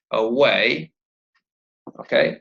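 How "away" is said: "away" with the weight on "way"; light first "a", long stressed "way" in "away"; a-WAY, "away" rising at the end; stress on second syllable. Shown as a demonstration. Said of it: "a stress on the syllable".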